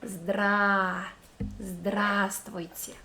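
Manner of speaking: "Здра-" alone, then Здравствуйте slowly with the long "a"; syllable-split demo. Shown as a demonstration. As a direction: break off "Здра-" then say the whole word slowly. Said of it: "this long sound ah".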